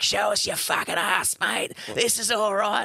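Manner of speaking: high, mocking yob jeer; jeering lads. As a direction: throw it at him like a cheeky lout. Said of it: "And they're like".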